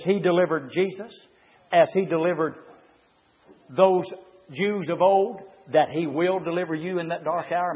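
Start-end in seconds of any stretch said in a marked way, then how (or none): none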